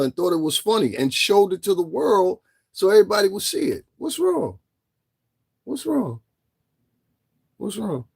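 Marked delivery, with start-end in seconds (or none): 4.0-8.0 high teasing "What's wrong?" fading softer each time. Real, not acted. Repeated mocking question, fading.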